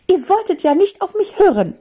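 high, sharp scolding voice; scolding Mathilda. A narrator's reading. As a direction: scold them like a cross old aunt. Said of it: "schimpfte Mathilda".

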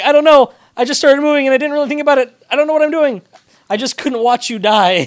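high, loud and fast, babbling panic; frantic Midoriya. Retold or imitated. Fan acting out Midoriya's panic to Bakugo, high, loud, rushed. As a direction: blurt it all out in a panic. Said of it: "he's like".

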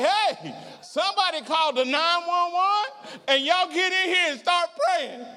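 high-pitched hammed-up cry for help; mock panic. Preacher playing himself fearing death, calling for help in mock panic. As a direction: yelp comically for emergency help. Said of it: "if I were in that situation".

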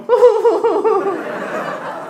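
shrill mocking laugh from the back; high-pitched hooting laugh; high cackling laugh. [name] laughing offstage.

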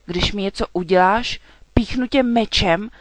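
loud, blustering frightened knight; panicked threat. A narrator's reading. Frightened knight threatening the dragon with his sword, blustering. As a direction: bluster loudly to hold off danger.

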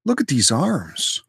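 high, laid-back surfer dude; goofy beach bro showing off. Pretend. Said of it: "Why am I talking like a surfer dude?".

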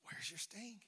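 hushed, mocking whisper to death; whispered taunt. Preacher taunting death, whispered and mocking.

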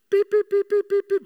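high monotone warning beeps; rapid, evenly pitched electronic beeps; car alert beeping. Retold or imitated. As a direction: beep like a lane alarm on one high note. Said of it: "gives you a little beep".

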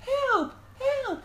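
very high, loud crying call; desperate cry for help. Acted. Lost boy answers the searchers, crying out desperately.

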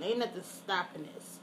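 usual speaking voice

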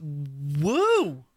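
Ric Flair woo. Retold or imitated. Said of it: "You do the Ric Flair?".

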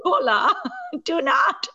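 laughing plea. Acted. Viewer pleading with naive film character Bhola, laughing. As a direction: plead helplessly while cracking up.

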